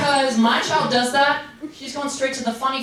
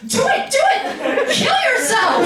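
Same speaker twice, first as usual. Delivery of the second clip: much higher, louder, slow chant of do it; a hyped little brother cheering; hammed-up excited kid yelling; egging on; 12 semitones higher, 4 dB louder than usual. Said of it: "he was like".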